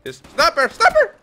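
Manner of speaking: excited. Real, not acted. Excited about the sniper.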